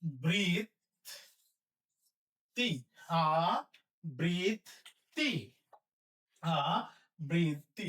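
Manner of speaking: আবৃত্তি very slow as aa... brit... ti; syllable-by-syllable demo. Shown as a demonstration. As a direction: dictate আবৃত্তি in three separated syllables. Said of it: "তাহলে কি হবে?". (আবৃত্তি ধীরে তিন ভাগে ভেঙে)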